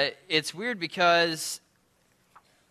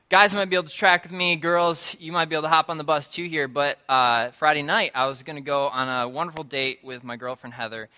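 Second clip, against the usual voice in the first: normal talking voice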